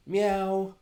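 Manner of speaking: high, loud cartoon cat meow into the mic; first take of a playful meow. Shown as a demonstration. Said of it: "I'll record".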